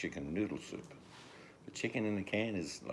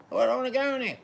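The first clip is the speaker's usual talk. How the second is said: very high, loud squawking chicken; squeaky chicken voice; 12 dB louder, faster than usual. Retold or imitated. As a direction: squeal it like a chicken being stuffed in a can. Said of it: "the noise the chicken makes".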